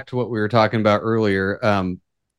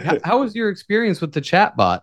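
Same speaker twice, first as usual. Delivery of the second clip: higher-pitched, polite service voice; chatbot manager; 6 semitones higher than usual. Acted. Manager greeting an angry caller after the chatbot, mock-polite. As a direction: play a manager checking in on a customer. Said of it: "The manager comes on and is like".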